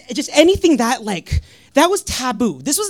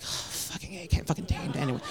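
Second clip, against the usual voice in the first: low, hushed grumble under the breath; half-whispered mock grumble; mock muttered aside; 7 semitones lower, 14 dB quieter, faster than usual. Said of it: "just like".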